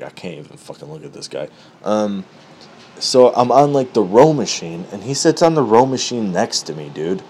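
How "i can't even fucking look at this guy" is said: muttered aside. Retold or imitated. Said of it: "'cause I'm like".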